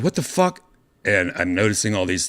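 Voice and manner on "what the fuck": high startled outburst; shocked. Retold or imitated. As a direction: jolt awake in disbelief. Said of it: "I was like".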